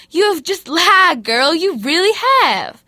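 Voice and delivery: loud, sassy rebuke; scolding Jesus. Retold or imitated. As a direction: call her out on the lie.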